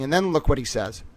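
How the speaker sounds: ordinary talk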